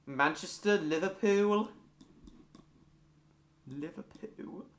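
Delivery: tentative Scouse, drifting toward Welsh; Liverpudlian accent attempt. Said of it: "if that was Welsh or Liverpudlian".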